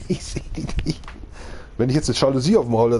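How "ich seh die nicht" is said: breathy laughing whisper. Real, not acted. Laughs, can't find her. (flüsterndes Lachen)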